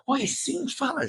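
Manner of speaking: high, strained mock-composed reply; holding back tears. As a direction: imitate someone gulping tears to answer. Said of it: "juntando a lágrima assim".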